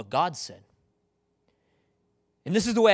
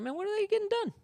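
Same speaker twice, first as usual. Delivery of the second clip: high-pitched, softer disbelief at a slacking coworker; mock exasperated aside, pitched way up; incredulous coworker; 12 semitones higher, 8 dB quieter, faster than usual. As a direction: boss baffled by a lazy worker, high and hushed. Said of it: "you're like".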